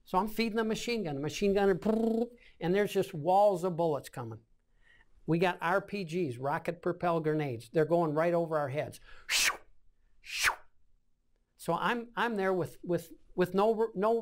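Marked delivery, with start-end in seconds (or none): 1.7-2.3 higher trilled burst of gunfire; mouth-made automatic fire; imitated machine-gun fire. 9.3-10.5 airy whoosh of passing rockets; two short hissed whooshes, spaced apart; mouth-made rockets flying overhead; imitated rockets.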